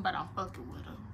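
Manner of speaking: ordinary delivery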